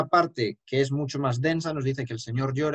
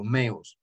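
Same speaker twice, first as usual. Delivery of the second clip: meus said firmly with a falling tune; stress on meus; 4 semitones lower than usual. Shown as a demonstration. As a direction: lean on meus. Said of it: "Hay que remarcarlo".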